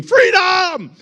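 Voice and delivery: high, loud battle shout; William Wallace scream. Retold or imitated. Preacher imitates William Wallace's high battle cry. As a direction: yell it like a war cry. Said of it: "like William Wallace screaming".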